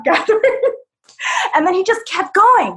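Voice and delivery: usual speaking voice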